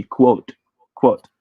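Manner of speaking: quote, quote, each with a clear W glide. Shown as a demonstration. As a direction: quote said kwohte, W after the K, twice. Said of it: "should be pronounced with a W".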